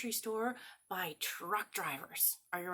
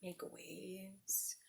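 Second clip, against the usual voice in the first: hushed whisper for slow waves; 11 dB quieter than usual. Shown as a demonstration. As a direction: breathe it softly. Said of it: "Can we go slow".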